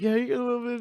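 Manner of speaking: high-pitched buddy voice. Retold or imitated. Buddy voiced, high, other timbre.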